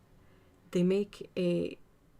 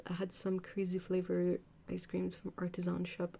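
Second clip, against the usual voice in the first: normal talking voice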